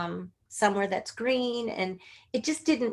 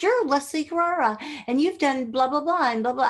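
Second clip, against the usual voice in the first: higher-pitched gushing imitation; excited greeting; 6 semitones higher, 4 dB louder than usual. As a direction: imitate someone gushing in recognition. Retells an excited first greeting.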